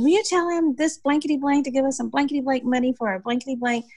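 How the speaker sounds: high-pitched, mock-indignant demand; retold irate demand. Irate community woman demands money through the newcomer, high indignant retelling.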